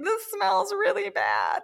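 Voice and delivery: high, put-on complaining voice of the fit model. Fit model trying on smelly jeans, high whiny complaint. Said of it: "She's like".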